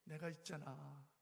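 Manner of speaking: a lightly voiced tender God; gentle voice of God. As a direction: speak slowly as a kind God. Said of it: "하나님께서".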